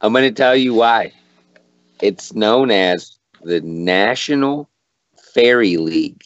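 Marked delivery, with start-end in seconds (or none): none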